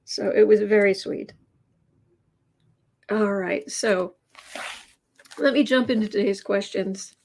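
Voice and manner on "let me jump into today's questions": normal talking voice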